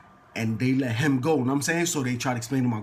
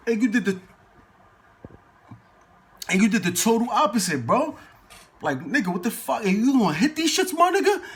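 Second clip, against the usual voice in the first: high-pitched, disbelieving rant; retold telling-off, climbing at the end; exasperated scolding; 8 semitones higher than usual. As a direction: act out being fed up with him. Acted scolding continues.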